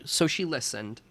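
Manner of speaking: ordinary delivery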